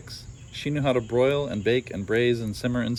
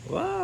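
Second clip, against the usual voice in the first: comic crash cry. Acted. Ogre trips and crashes.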